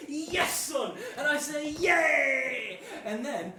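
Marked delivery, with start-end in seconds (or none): 0.1-1.0 loud, high, emphatic yes; acted granddad's happy confirmation; hearty granddad. Granddad answering the eager grandchild. 1.7-2.8 loud, high, drawn-out yay; acted childlike glee; child's cheer. Child cheering granddad's answer.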